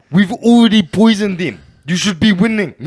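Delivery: loud mock taunt. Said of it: "I was like".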